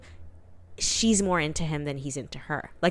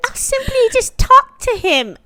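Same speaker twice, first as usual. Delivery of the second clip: high, loud mocking doctor voice; 8 dB louder than usual. Friend mocking the doctor, high and loud. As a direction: parody his smug advice. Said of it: "mocking the doctor".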